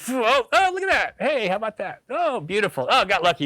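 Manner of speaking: very high, delighted exclaiming; excited. Retold or imitated. Reliving landing a steelhead, very high and thrilled. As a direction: gush over the fish. Reenacting hooking a fish.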